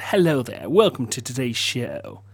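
low, soft, proper British accent; natural proper accent. Shown as a demonstration. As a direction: welcome them quietly in a proper British accent. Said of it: "in my natural, proper accent".

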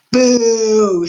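loud, long, high jeer; shouted boo. A narrator's reading. Botticelli jeering at the crying rat, shouted. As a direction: heckle with a drawn-out boo. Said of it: "shouted Botticelli".

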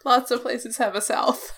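said through laughter; giggling as she speaks; laughing, pitched high. Real, not acted.

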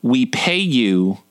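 slow, syrupy southern drawl; Beverly Leslie. Acted. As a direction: draw it out with catty southern sweetness. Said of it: "say it like Beverly Leslie from Will and Grace".

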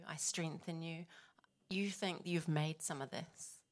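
soft, hushed voicing of a quiet rebuke; retold soft whisper. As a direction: gently imitate a quiet inner voice. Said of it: "the Holy Spirit just whispered to me".

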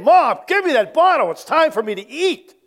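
mock baby yell, high and loud on Mom; hammed-up hungry baby shouting for the bottle; demanding baby scream. Preacher voicing a baby screaming for its bottle, mock yell. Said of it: "they're screaming and saying".

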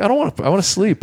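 high, fast whiny protest; whiny complaint. Retold or imitated. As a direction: whine like an exhausted kid. His tired retold reply.

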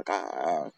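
crow's response. Shown as a demonstration. Crows talking to each other, low answering caw. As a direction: answer as the second crow, dropped down low. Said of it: "like a call and response".

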